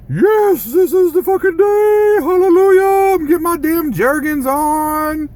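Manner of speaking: high, loud celebratory holler; mock overexcited. Imagined viewer rejoicing over the picture, mock overexcited. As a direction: whoop it up in exaggerated joy, very high. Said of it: "Don't get all excited".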